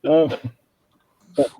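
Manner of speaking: muffled voice through a face mask; muffled behind mask. Real, not acted. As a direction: talk muffled, barely breathing through the mask. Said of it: "you were sounding like that Batman villain".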